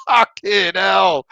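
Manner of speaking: slow, laughing curse; swearing through laughter. Real, not acted. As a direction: still laughing at the chat comment. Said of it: "it made me laugh".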